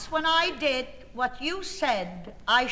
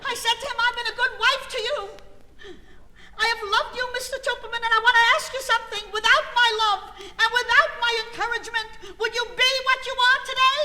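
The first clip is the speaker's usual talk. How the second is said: much higher and louder, building fast; rising tirade; 10 semitones higher, 5 dB louder, faster than usual. Retold or imitated. Wife scolding her husband in the restaurant, high and furious. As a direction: let the outrage boil over, faster and faster.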